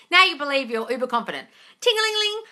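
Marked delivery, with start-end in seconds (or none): 1.8-2.4 high sing-song ting-a-ling; bell ringing sound. Pretend. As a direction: chime it like a tiny bell. Mimics a little bell ringing.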